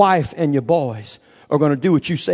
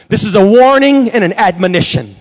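loud, raised admonition, slowing for weight at the end; forceful preaching; 6 semitones higher, 6 dB louder than usual. Real, not acted. Preacher warning young people their parents' faith is not enough, forceful preaching. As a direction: drive the warning home from the pulpit.

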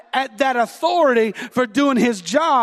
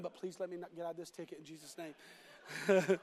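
hushed prayer; 5 semitones lower, 17 dB quieter than usual. Retold or imitated. Praying while stopped by police.

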